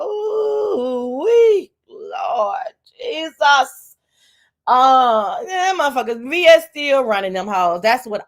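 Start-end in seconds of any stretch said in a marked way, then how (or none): 0.0-3.9 shocked exclamation. Real, not acted. Reacting to jail news.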